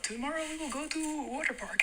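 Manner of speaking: high, sing-song mock Russian accent; Russian guys. Storyteller quoting the Russians' water park plan, high mock Russian accent. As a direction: imitate a Russian making plans in English. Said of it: "remember the night before them saying".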